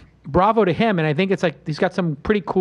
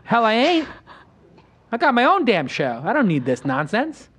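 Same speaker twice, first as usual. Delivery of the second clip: higher, emphatic comeback with pauses; retold defiant retort; 5 semitones higher than usual. Host retelling his comeback to producers, defiant. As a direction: throw the retort back proudly.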